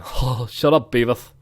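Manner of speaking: mock cartoon slacker voice, lisped; Butt-Head style put-down. Streamer mocking his own Beavis laugh, cartoon put-down voice. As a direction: tell him off like a cartoon slacker. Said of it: "Sound like Beavis then".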